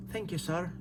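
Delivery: a soft, lightly put-on Irish accent. Answering the Irish commenter in a soft Irish accent. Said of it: "Is that my Irish accent?".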